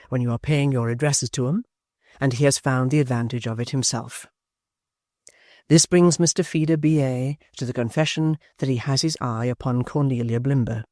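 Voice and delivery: ordinary delivery, nothing marked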